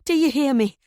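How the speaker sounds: high, loud shout. A narrator's reading. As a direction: yell down at him. Wife shouting at fallen husband.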